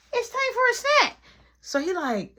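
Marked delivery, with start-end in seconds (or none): 0.1-1.2 put-on high childish whine for a snack; whiny kid. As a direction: beg like a little brother. Repeats Marky line.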